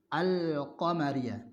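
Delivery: Al-qamariyah slow, lam clearly sounded; lam read. Shown as a demonstration. Teacher models Al-qamariyah for students, slow. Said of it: "Cara membacanya seperti membaca".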